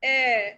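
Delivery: "এ" with chandrabindu, nasalised open e; nasal ä sound. Shown as a demonstration. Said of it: "তাহলে উচ্চারণটা কি হয়?". (চন্দ্রবিন্দু দিয়ে নাকি "এ")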